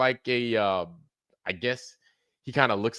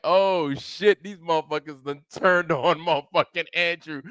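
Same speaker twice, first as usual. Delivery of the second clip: mock surprise; 6 semitones higher than usual. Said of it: "I'm like".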